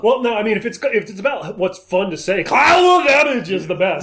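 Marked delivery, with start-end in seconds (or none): 2.4-3.5 loud, high mock movie shout; Arnold shout. Friend yells the fun line in a mock Arnold bellow. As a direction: bellow it like an action hero.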